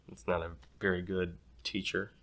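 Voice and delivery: ordinary delivery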